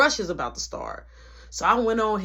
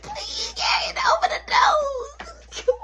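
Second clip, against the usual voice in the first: screaming high, half crying, half laughing; panicked plea; slower than usual. Retold or imitated. Begging the coworker to open the door, in a panicked shriek. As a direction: shriek it like you're trapped and desperate. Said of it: "I'm panicking".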